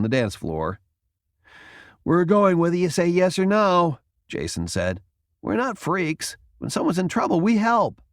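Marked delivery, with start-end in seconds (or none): 2.0-4.0 defiant Jason. A narrator's reading. Jason defies Valentino. 5.4-8.0 earnest Jason. A narrator's reading. Jason insists.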